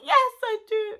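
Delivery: high, loud, insistent mock wail; hammed-up exasperated cry; retold desperate yell. Woman in labour answers mum's doubt, desperate mock yell.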